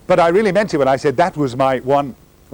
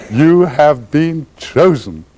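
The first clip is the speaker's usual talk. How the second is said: very slow, laughing through each word; laughing, drawn out; 2 semitones higher, much slower than usual. Acted. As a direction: savour it while laughing.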